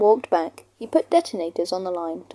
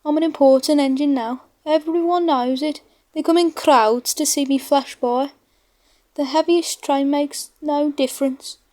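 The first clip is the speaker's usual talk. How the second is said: higher, boastful engine voice; conceited James; 6 semitones higher, 3 dB louder than usual. Acted. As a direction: talk down to little Toby, show-off. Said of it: "James got very conceited about it".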